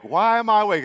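mock annoyance on waking; mad at waking. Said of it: "so mad you're awake".

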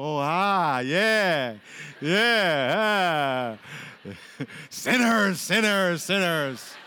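loud, hammed-up preacher calling out sinners; mock hellfire preacher. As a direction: belt it like an old-time revival preacher. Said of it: "Then I would go".

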